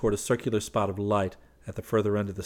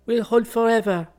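put-on high, loud girlish voice, composed; a bright calm young woman; calm Colette; 13 semitones higher, 6 dB louder than usual. Colette calmly reassures the frightened men, high and loud. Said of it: "observed Colette calmly".

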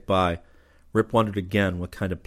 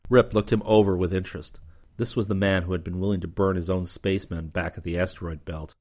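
usual speaking voice

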